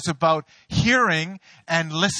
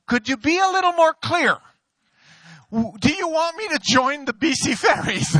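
much higher, mock-pleading question; exasperated plea; 9 semitones higher than usual. Pastor asking God for a clearer sign, high mock plea. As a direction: whine it up to the heavens for clarity.

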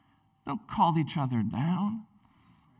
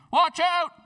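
sharp high shouted warning, much louder; 12 semitones higher, 4 dB louder than usual. Retold or imitated. Buddy on the cliff shouts down a warning, loud and high. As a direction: yell it up the cliff face as a rock falls.

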